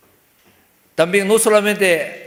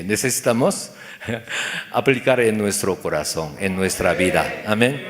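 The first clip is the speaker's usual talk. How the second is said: ordinary talk, nothing marked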